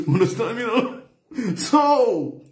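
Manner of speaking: high, slow laughing wail. Real, not acted. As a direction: laugh until it sounds like crying.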